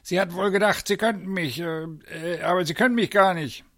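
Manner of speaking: high put-on voice of an indignant director; bluster, stumble, then rush the comeback; blustering call. A narrator's reading. Zoo director taunts the uncle, blustering. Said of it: "rief er".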